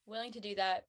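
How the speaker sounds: ordinary delivery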